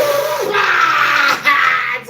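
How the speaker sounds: a loud, high mocking laugh-shout. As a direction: blast out a derisive laugh. Mocking the adults' advice.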